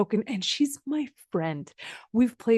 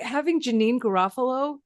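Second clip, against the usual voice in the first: normal talking voice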